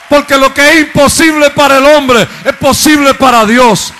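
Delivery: loud, high preached proclamation; shouted sermon refrain. Acted. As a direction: proclaim it at the top of your voice.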